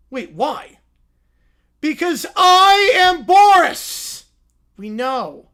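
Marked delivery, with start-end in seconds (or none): 1.8-4.2 loud, slow, high crying yell; sobbing referee shout. Acted. Shirtless Boris ends the fight to the crowd, crying loudly. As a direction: end the fight through hammed-up sobs.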